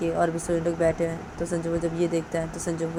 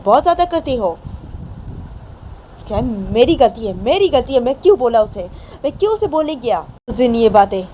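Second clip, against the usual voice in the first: loud, raised, angry scolding of the sisters; angry outburst; 6 semitones higher, 7 dB louder than usual. Acted. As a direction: blame himself out loud, fuming. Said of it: "अपनी दोनों बहनों के ऊपर गुस्सा करने लगता". (गुस्से में ऊँची आवाज़ में डाँटना)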